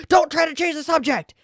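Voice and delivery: high, sharp, indignant cry; angry retort. Acted. Shouting down the pushy water salesman, angry. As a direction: cut the salesman off hard.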